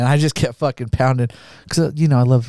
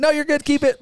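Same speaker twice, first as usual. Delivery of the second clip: imitating himself, pitched higher; mock breezy. Drunk rider refusing help from bystanders, high mock cheer. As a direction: wave them off in a high put-on voice. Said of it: "I'm like".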